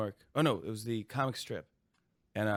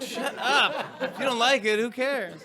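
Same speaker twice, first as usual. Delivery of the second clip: mock retort; 12 semitones higher, 4 dB louder, slower than usual. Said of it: "it's like".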